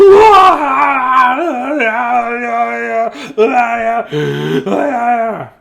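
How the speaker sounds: long high shouted yell; a big startled cry, held long; loud high startled yell; father's yell. Acted. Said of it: "And his father yelled".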